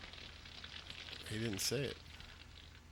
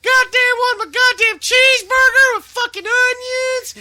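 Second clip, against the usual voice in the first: much higher, much louder enraged screech; imitating a screaming fit; 12 dB louder than usual. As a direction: scream it like a manager losing it. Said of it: "Cornette had a fucking fit".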